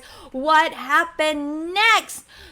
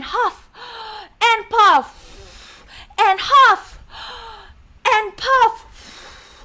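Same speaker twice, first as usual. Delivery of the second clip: high, loud words with gusts of breath; huffing and puffing wolf; 8 semitones higher, 3 dB louder, slower than usual. Shown as a demonstration. Storyteller leads kids huffing at the house, loud and breathy. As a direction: punch each word, then blow. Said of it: "Can you huff again".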